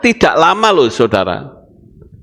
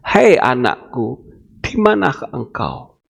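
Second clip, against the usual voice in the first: gentle inner voice; 5 semitones lower than usual. Acted. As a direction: call gently, like a voice in the heart. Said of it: "Tuhan itu masih bersuara di dalam hati kita".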